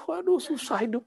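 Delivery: lightly acted lament about a hard life; mock complaining. A wali grumbling to someone who meets him, mock complaining. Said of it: "terus dia ngeluh".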